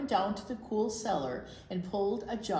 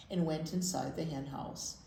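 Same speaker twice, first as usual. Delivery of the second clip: usual speaking voice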